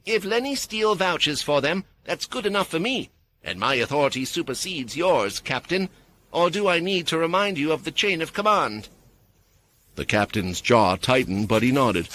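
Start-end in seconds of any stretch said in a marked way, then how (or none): 0.1-8.9 authoritative woman. A narrator's reading. Mia pulls rank on captain.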